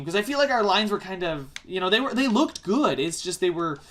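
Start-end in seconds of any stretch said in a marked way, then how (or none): none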